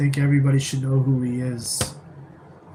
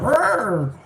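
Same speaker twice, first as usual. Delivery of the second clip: growl; 8 semitones higher than usual. Retold or imitated. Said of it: "I'm just like".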